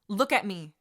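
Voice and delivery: stern order. Acted. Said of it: "she ordered".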